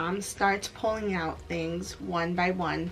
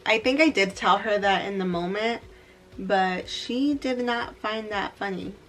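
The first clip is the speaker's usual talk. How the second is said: ordinary talk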